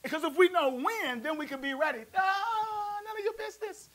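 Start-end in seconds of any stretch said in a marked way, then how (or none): 2.2-3.9 high, breezy brush-off; mock high sassy reply; sassy dismissal. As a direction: brush them off playfully. Preacher's sassy answer.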